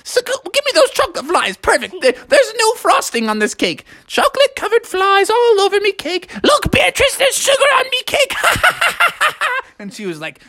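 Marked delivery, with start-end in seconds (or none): 0.0-8.3 excited party-host frog; animated, gleeful character voice; delighted old frog. Acted. 8.4-9.7 high, rapid character laugh; hammed-up happy frog laugh; gleeful cackle. Froggy gloats over his sugary cake.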